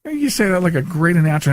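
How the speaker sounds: ordinary delivery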